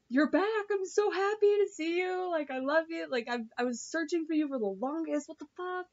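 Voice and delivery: high, gushing imitation of a joyful husband; excited and happy. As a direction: gush with relief as the overjoyed husband. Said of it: "so excited and so happy".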